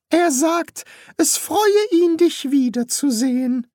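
very high-pitched put-on priest voice. Priest relaying the ghost's greeting to the son, high put-on voice. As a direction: pitch the priest far above the narrator.

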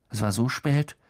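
ordinary delivery

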